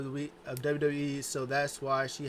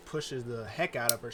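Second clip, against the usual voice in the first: ordinary delivery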